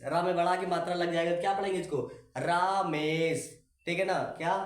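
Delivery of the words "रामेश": रामेश with a long, drawn-out, weighted रा; रामेश, रा held long and heavy, slowly. Shown as a demonstration. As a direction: stretch the रा in रामेश, slightly heavier voice. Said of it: "हल्का भारी आवाज में पढ़ना".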